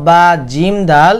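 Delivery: ordinary talk, nothing marked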